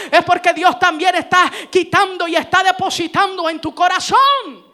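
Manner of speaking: loud, insistent sermon build; full-force preaching. Real, not acted. Preacher assuring congregation God is working, loud impassioned preaching. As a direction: drive each phrase harder up to a shouted finish.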